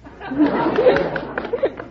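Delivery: a high burst of laughter; host cracking up at the contestant; high hearty laugh. Real, not acted.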